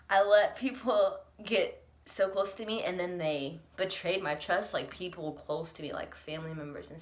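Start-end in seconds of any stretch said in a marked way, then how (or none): 0.1-2.8 voice breaking. Real, not acted. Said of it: "Oh, there it goes".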